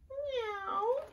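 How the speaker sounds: high, small imitation meow; cat meow. Reader playing Cat before the pounce, high meow. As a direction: mew like a little cat.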